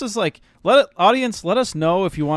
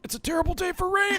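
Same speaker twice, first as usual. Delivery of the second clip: high put-on voice, mock dramatic; joking meme quote; 4 dB quieter than usual. Friends joking about crying over the anime, high mock voice. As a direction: pretend to hide tears behind the line. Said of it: "that's the way I'm crying".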